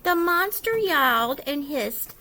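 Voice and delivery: ordinary delivery, nothing marked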